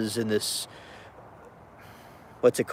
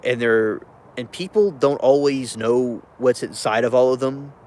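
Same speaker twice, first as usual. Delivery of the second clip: ordinary talk, nothing marked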